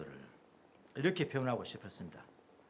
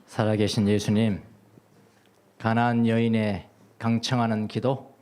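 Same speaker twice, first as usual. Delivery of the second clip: loud, slow pulpit prayer; preacher's fervent closing prayer; 4 semitones lower, 10 dB louder, slower than usual. Real, not acted. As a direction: pray out with full force.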